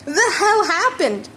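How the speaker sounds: angry-sounding confused shout; yelling. A narrator's reading. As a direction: bark out a confused demand. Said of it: "Bakugo yelled at him".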